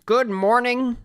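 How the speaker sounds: high-pitched put-on David Lynch greeting. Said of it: "I do an okay David Lynch".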